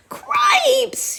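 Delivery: high squeaky mouse shout; panicked mouse yelp. Acted. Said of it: "shouted Furlow again".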